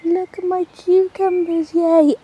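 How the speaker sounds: ordinary talk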